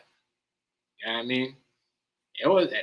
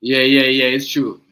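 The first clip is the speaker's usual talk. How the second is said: loud agreement; 4 semitones lower, 9 dB louder, slower than usual. Real, not acted.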